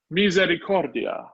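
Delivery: Misericordia with COR as the stressed syllable. Shown as a demonstration. Teacher showing where the stress falls, Misericordia stressed on COR. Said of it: "The stress is on core".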